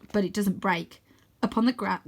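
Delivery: ordinary delivery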